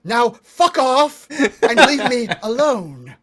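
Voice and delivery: put-on loud, furious old witch with a laugh in it; angry old woman. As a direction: tell them off and slam the door. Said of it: "She goes".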